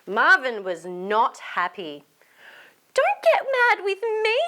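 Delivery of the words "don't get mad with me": high pleading voice; Molly voice. Acted. Molly asks Marvin not to get mad, high pleading voice. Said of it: "said Molly".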